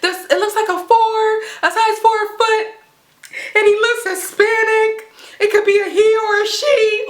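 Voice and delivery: high-pitched panicky reenactment; freaking out. Retold or imitated. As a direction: act out flustered answers to a dispatcher, voice high. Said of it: "freaking out".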